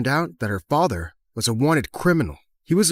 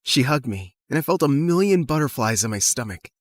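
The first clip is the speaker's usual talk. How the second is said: usual speaking voice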